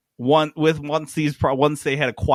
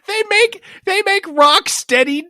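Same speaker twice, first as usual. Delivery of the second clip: laughing disbelief; 13 semitones higher, 4 dB louder than usual. Real, not acted. Incredulous laughing question.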